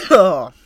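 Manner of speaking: very loud, high throaty T-Rex roar; throat-tearing dinosaur bellow. Shown as a demonstration. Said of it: "that was my T-Rex impression".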